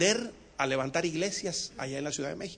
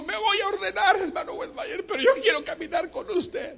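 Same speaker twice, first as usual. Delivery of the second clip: high promise; 4 dB louder than usual. Acted. Chanito promises to tithe.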